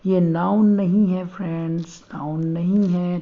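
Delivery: spelled out slowly for the class; teacher slowing down to drill "नन" not "noun"; slow and deliberate. Shown as a demonstration. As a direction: let each word land slowly. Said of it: "विशेष ध्यान रखिए".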